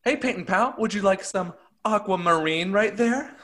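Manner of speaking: high-pitched, cutesy offer; sweet painting pal. Acted. Painting pal offering paint to pal, high sugary voice.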